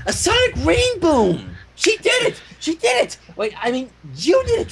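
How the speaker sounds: very high, ecstatic cheering; excited squeal. Acted. Pinkie cheering Twilight's trick. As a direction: bounce with joy. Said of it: "hopped from hoof to hoof in excitement".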